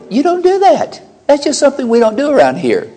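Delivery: high, stern parent voice laying down the rule; scolding parent. Pretend. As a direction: scold the child like a strict mom. Parent scolding a sassy child.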